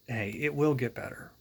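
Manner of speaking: plain reading voice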